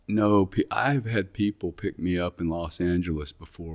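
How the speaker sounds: ordinary talk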